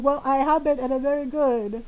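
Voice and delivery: put-on Filipino-accented mom brushing it off; mom's Filipino accent. Mom praising the gelato, in her Filipino accent. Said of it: "in my mom's voice".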